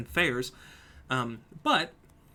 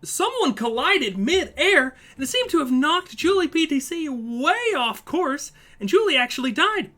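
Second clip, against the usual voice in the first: higher, louder, slow excited call of a crash; excited race call; 11 semitones higher, 9 dB louder than usual. Real, not acted. Commentator reacting to a mid-air crash, loud and excited. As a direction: shout it out as the crash happens.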